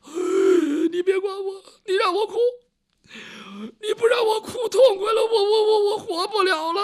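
high, loud crying protest; sobbing wail. Acted. Guo Huai sobs at Yang Zhong to let him cry, wailing loudly. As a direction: cry out, refusing to be comforted. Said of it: "他这可是真哭".

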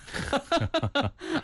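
high, quick burst of laughter; amused real laugh. Real, not acted. Host laughs after joke about tinsmiths.

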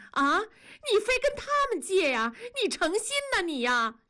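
high-pitched, exasperated scolding; furious. Acted. Wife berating husband for borrowing from neighbours, furious. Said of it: "文丽已经气得说不出话了".

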